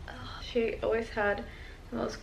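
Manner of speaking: soft unvoiced whisper; hushed in fond memory. Real, not acted. Whispering over grandma's old ornaments.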